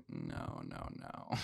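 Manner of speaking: low, hushed string of no's; quiet retold refusal. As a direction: murmur the refusal under your breath. Retold dismissal from the show.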